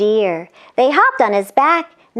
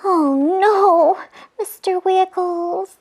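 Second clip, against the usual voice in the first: put-on much higher little-girl voice; worried sister; high worried angel; 7 semitones higher, slower than usual. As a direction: gasp it in alarm. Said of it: "said Angel Scarlet".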